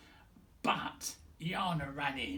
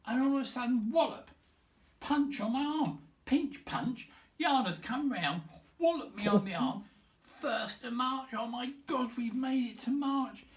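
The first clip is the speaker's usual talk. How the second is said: higher and louder, bursting with it; excited retelling; 8 semitones higher, 6 dB louder than usual. Real, not acted. Recalling the first of March pinch punch, excited and much higher. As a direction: tell it like a fun jolt.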